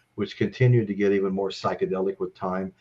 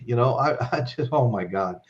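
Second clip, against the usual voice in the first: ordinary talk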